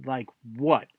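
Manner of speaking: low, soft, wary question; suspicious. Acted. Fish sizing up god's offer of a favor, suspicious. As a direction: narrow your eyes and ask warily. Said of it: "narrows his beady fish eyes and goes".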